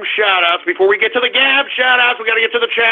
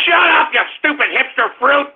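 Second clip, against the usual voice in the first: raised, high-pitched outburst; mock outraged yell; 6 semitones higher than usual. Host snaps back at his own hipster character, mock outraged yell. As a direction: shout down the annoying hipster.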